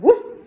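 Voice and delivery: high, loud bark of a woof; dog woof. Acted. The dog barks a loud high warning. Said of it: "said the dog".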